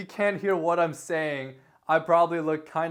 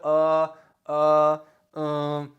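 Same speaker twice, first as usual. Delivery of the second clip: filler ums; 5 dB louder, much slower than usual. Shown as a demonstration. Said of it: "you just keep saying ums".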